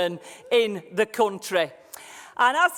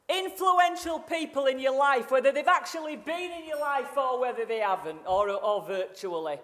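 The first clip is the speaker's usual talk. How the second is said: ordinary talk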